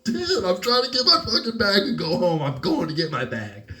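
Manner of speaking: high at first, laughing through the words; laughing retelling. Retold or imitated. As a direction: laugh while replaying your own reply. Quoting himself while laughing.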